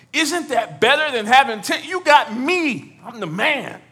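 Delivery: loud, high, self-satisfied mock brag; hammed-up boasting. Husband telling childless wife he is enough, hammed-up boast. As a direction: stretch it out, puffed up and proud. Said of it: "He says".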